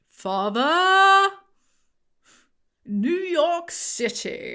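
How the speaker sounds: booming, high and very slow, theatrical; over-the-top Matt Berry grandeur. Acted. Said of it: "do a Matt Berry voice".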